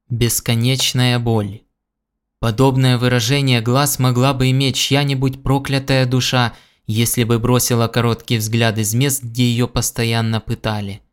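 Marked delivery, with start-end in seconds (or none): none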